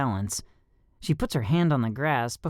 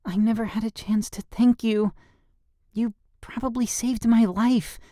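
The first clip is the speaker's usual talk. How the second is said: put-on girl voice; 10 semitones higher than usual. A narrator's reading. Girl's voice in story.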